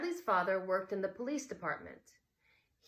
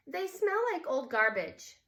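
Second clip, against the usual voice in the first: put-on higher, louder girl's voice; Claudia's voice; 8 semitones higher, 6 dB louder than usual. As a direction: say it as a disgusted little sister. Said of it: "said Claudia".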